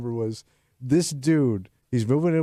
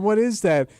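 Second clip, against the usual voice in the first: retold scream; 7 semitones higher, 4 dB louder than usual. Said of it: "screaming at him".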